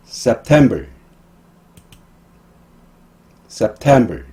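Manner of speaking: "September", "October", "November" stressed on TEM, TO, VEM; light first syllable, strong second in "September", "October", "November". Shown as a demonstration. Teacher modelling months for repetition, second-syllable stress. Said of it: "모두 두 번째 음절에 강세가".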